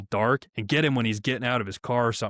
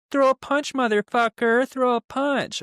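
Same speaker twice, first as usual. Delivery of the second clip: very high, loud mock scream; imitated screaming; 14 semitones higher, 5 dB louder than usual. Housemate goading him on the stairs, mimicked screaming. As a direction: screech it as a goading challenge, in mimicry. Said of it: "he started screaming at me".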